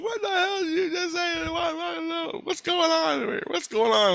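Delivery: very high-pitched mock indignation; outraged mother impression. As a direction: play a frantic mom demanding answers. Acting out the angry mother again.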